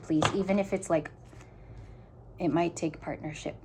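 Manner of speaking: ordinary talk, nothing marked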